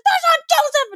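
put-on very high, shrill-pitched child's outburst; child shouting. Little brother insisting loudly in the balcony, very high child's voice. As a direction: yell it like a stubborn four-year-old. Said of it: "He was like".

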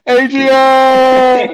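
screamed high and very loud; Rocky scream. Retold or imitated. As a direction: yell it like Rocky. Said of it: "like Rocky when he's screaming for Adrian".

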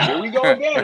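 a told-you-so chuckle; knowing, laughing. Retold or imitated. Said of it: "I knew it".

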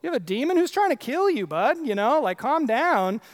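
high, loud, incredulous "whoa, who's trying to kill you, bud"; mock the crowd as a dismissive bro. Preacher mocking the crowd's scoffing in a high loud voice. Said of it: "They're like".

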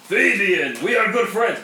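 loud high call. Acted. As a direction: call it out loudly. Calls loudly to friend.

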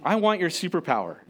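ordinary talk, nothing marked

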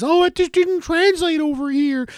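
high, drawn-out mimicking whine; mocking his co-host. Host mimicking co-host's defense of Blues Brothers, high mock voice. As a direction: parody a friend's excuse in a high, slow voice. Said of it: "similar to your defense of".

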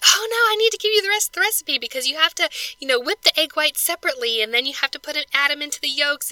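brightly insisting on the recipe; eager friend voice. Retold or imitated. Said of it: "she was like".

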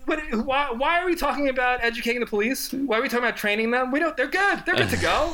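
very high, laughing sarcastic rant; sarcastic squeal. Retold or imitated. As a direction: squeal it in mock outrage.

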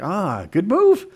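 mock smug approval. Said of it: "you're like".

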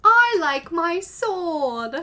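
cartoon boy voice.

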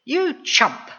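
high, scolding mother's jab; understated angry yell; mild yell. A narrator's reading. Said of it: "she yelled".

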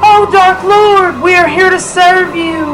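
high, slow worshipful voice; fawning cultist. Acted. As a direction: grovel before your dark master. Cultist greeting the demon.

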